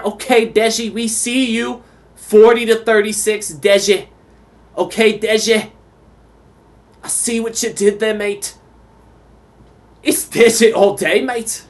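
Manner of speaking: high-pitched, badly faked Fat Bastard accent; a botched stab at Austin Powers' Scottish villain accent. Commentator cheering Deji's win in a terrible Fat Bastard accent. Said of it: "go for the fat bastard accent".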